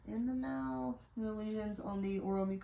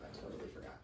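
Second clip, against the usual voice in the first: whispered aside; 11 dB quieter, much faster than usual. Real, not acted. Aside while fetching stethoscope.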